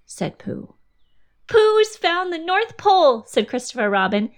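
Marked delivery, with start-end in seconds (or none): 1.5-3.2 high, loud triumphant declaration; excited announcement. A narrator's reading. Christopher Robin telling Pooh he found the Pole, triumphant. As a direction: announce it like a big discovery.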